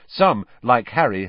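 plain reading voice

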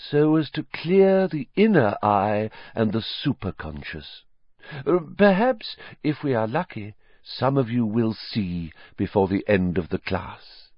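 high, airy mystic teacher; dreamy seer voice. Acted. Trelawney to her class, airy mystic voice. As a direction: float the words like a misty prophetess.

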